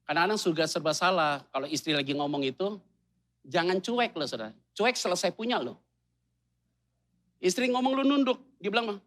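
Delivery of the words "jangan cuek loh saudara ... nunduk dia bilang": ordinary delivery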